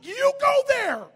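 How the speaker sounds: high, loud, drawn-out retort; mock defiant shout. Young man refusing the holy lady, hammed-up shout. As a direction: throw it back at full volume, slowly.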